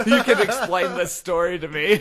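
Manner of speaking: cracking up mid-sentence at his own comeback; laughing. Real, not acted. Laughing while retelling cop story.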